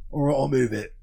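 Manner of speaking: lightly voiced London geezer shrugging it off; Phil Mitchell voice. Retelling EastEnders to a friend, a light Phil Mitchell impression. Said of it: "That's my Phil Mitchell voice".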